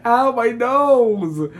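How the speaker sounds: loud, high, drawn-out yelp; pained whine. Real, not acted. Friend complaining her nose hurts, pained whine. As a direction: stretch out a loud complaint of pain. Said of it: "my nose hurts so much".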